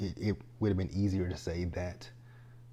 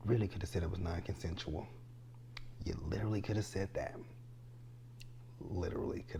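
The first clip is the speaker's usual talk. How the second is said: whisper; 9 dB quieter, faster than usual. Real, not acted. Emphatic aside to viewers.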